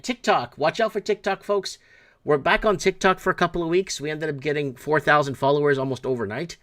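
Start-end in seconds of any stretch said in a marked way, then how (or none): 0.0-4.0 excited plug. Real, not acted. Hyping TikTok return.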